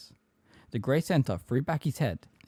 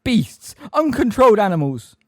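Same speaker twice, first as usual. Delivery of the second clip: high, very loud outrage; screamed insults; 11 semitones higher, 10 dB louder than usual. A narrator's reading. Umbridge abusing the centaurs, high outraged scream. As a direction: scream abuse at the herd. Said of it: "she screamed".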